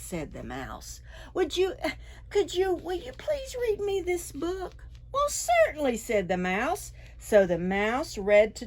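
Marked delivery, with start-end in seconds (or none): 1.3-4.7 much higher, stumbling shy request; hesitant hopeful dragon. A narrator's reading. Dragon nervously asking the mouse to read, high and unsure. 5.1-6.0 much higher, gracious and glad; delighted little mouse. A narrator's reading. Mouse happily agreeing to read to the dragon.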